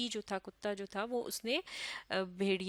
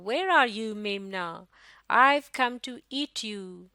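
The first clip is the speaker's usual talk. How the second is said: loud, menacing wolf calling out; big bad wolf; 7 dB louder, much slower than usual. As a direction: boom it out like a hungry wolf hunting. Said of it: "और फिर उसने कहा".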